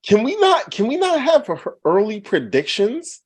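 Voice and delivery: high, loud protest; exasperated shout. Real, not acted. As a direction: yell it defensively. Defensive outburst at co-hosts.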